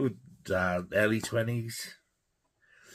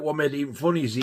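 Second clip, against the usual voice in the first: normal talking voice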